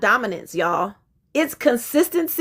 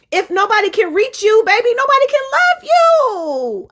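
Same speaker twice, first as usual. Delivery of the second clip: rising emphatic; 8 semitones higher than usual. Real, not acted. Tender address to women.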